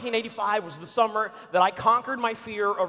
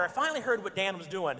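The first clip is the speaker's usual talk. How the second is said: usual speaking voice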